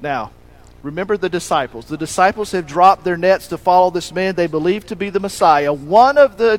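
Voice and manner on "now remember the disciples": usual speaking voice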